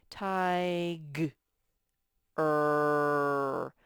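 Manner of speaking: "Tig-" then "er" split into two slow beats; syllable by syllable. Shown as a demonstration. Teacher to class, sounding out the word tiger in syllables. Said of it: "The er sound is spelled".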